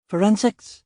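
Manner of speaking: rattled junior officer, eager to please; flustered young constable. Acted.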